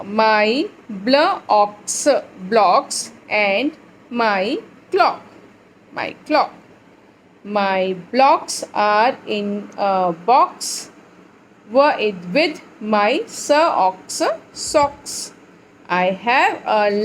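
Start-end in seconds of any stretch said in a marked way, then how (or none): 0.0-10.9 very slow, word by word; slow reading aloud. Shown as a demonstration. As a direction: drag each word for children. Teacher reading slowly to class.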